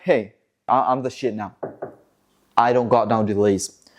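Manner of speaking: low, swaggering boast; cocky brag. Acted. Honda's cars boasting about having no delays, low cocky voice. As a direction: drop the voice low and strut it.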